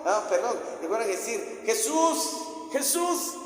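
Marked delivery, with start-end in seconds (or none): 1.7-3.3 high, drawn call to get attention; acted calling out. Messenger running to find Jesus, high acted call. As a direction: call the master urgently.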